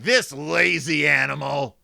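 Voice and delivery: loud, drawn-out grumble at an animal; irked Farmer Ben. Acted. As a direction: play an exasperated farmer, slow and loud. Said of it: "Farmer Ben's like".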